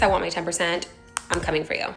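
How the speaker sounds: normal talking voice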